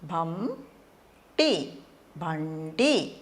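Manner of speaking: బండి split as భం టి, then said whole slowly; syllable by syllable. Shown as a demonstration. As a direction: sound out బండి in parts, then join. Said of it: "దీన్ని ఎట్లా పలకాలంటే". (అక్షరాలుగా విడదీసి నెమ్మదిగా)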